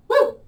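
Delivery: high-pitched "woo" of effort; high whoop. Real, not acted. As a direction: let out a quick, high whoop. Whoops during the exercise.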